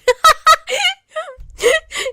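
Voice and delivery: a high, squealing laugh that breaks out of the rant; high shrieking laugh. Real, not acted. Laughing helplessly at his own spider rant, high shrieking laugh. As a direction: lose it completely, howling with laughter.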